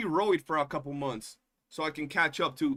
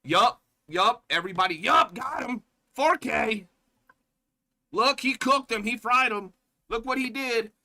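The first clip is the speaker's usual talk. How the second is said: louder, higher mock cheering; higher, louder fake cheering; mock chat hype; 6 semitones higher, 5 dB louder than usual.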